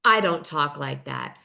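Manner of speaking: low, slow, measured delivery; QVC voice. Retold or imitated. [name] protests to Keiarna, in a mock QVC voice. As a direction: lightly imitate a measured shopping-channel presenter. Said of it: "you've still got your Q V C voice on".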